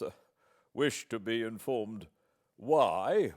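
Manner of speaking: cold restraint with anger showing through; a proud man keeping his temper by force; forced calmness. Acted. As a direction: hold the anger down and stay icily polite. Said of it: "with a voice of forced calmness".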